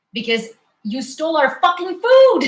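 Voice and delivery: loud, high, indignant yell; real outrage bursting out, loud and high; furious outburst. Real, not acted. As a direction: blow up at them about the food. Outraged about stolen borscht.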